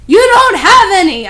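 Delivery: high, loud unhinged yell; hammed-up furious yell; mad yell. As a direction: yell it madly. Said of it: "I yelled in madly".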